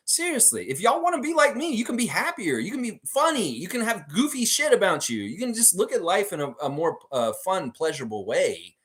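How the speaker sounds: high, animated pep talk; upbeat urging. Real, not acted. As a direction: pitch it way up, urging listeners along. Urges viewers to be happier.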